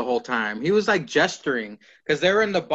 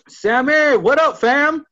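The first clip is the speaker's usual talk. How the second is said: put-on forced young lingo; 11 semitones higher, 8 dB louder, slower than usual. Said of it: "I know the young lingo".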